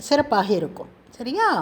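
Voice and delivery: ordinary delivery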